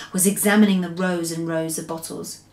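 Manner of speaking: usual speaking voice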